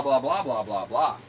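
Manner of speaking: normal talking voice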